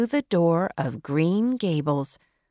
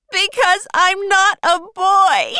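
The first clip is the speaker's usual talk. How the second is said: very high, loud heartbroken cry; shouting in distress; 14 semitones higher, 8 dB louder than usual. Acted. Girl shouts at Marilla, loud and heartbroken. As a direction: wail it out at full volume. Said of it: "she shouted".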